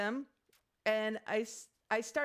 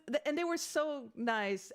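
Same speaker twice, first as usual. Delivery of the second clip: usual speaking voice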